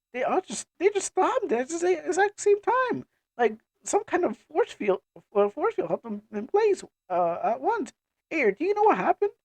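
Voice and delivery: high-pitched, bewildered character voice; baffled companion. Acted. Companion asking Ayer what happened, high and baffled. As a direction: pitch it high, puzzling aloud.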